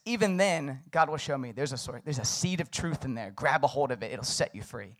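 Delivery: usual speaking voice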